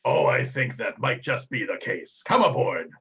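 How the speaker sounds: slow, hearty captain voice; Captain Tumult Viral. Acted. Captain charmed by the elf maiden, slow hearty welcome. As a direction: agree slowly, then call out the welcome. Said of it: "Captain Tumult, uh, Viral".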